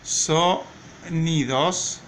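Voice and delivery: "sonidos" very slow, broken after "so"; syllable by syllable. Shown as a demonstration. Teacher dictating for writing, "sonidos" slowly split by syllable. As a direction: stretch "sonidos" with a pause between syllables. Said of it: "Miren cómo se escriben".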